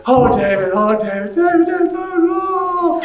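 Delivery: imitating sisters fawning over him; gushing sisters.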